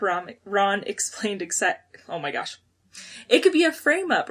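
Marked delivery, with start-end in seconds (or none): 3.3-4.3 bright, eager reread of Ron's line; excited reading. Retold or imitated. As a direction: say it like Ron's got a hunch. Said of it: "Ron exclaimed excitedly".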